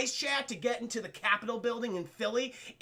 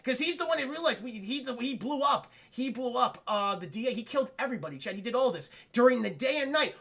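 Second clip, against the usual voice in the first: ordinary delivery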